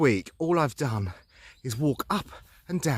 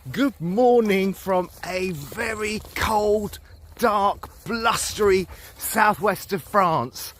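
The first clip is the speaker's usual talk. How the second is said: TV reporter; 4 semitones higher, 4 dB louder, much slower than usual. Acted. Mock TV reporter greeting.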